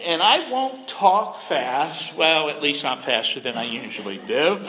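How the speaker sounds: normal talking voice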